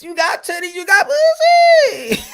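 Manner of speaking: climbs high into a laughing shout. Acted. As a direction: cracks up as the line peaks. Snake urging Eve, laughing.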